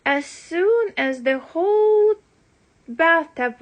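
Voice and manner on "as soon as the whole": ordinary delivery, nothing marked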